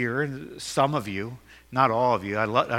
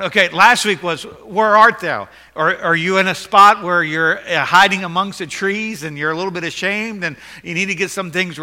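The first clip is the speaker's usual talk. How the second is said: loud, high, full-force sermon; preaching; 6 semitones higher, 8 dB louder than usual. Acted. Pastor to congregation, preaching at full force.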